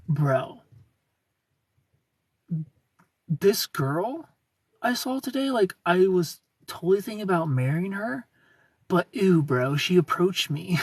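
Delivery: lightly acted disgusted bro; mock appalled guy. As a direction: play a guy aghast to his buddy. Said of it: "no guy is ever gonna be like".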